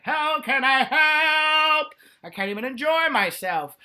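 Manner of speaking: high, loud, slowly drawn-out offer of help. Retold or imitated. Lesbians unable to relax in bed, high drawn-out mock helpfulness. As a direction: stretch out the help like a martyr. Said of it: "They're like".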